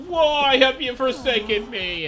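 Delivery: slow, high, loud theatrical wail; dramatic lament. Pretend. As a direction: wail it like a martyr. Dramatic lament after being caught.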